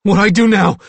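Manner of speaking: high, loud, panicky question; distraught character voice; frightened wail. A narrator's reading. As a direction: cry it out like a cornered boy. Billy cowering and sobbing.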